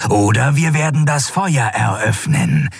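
disembodied voice issuing a threat, mildly hissed; hissing disembodied voice. A narrator's reading. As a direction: deliver the demand as an unflinching bodiless voice. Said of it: "zischte die körperlose Stimme unbeirrt".

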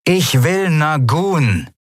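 distant loudspeaker order; over a loudspeaker. A narrator's reading. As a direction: command over a tinny intercom. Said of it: "rauschte … aus einem Lautsprecher".

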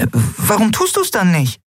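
very high-pitched, pressing question; impatient high character voice. A narrator's reading. Nelen pressing Ailan to use the splint, high and impatient.

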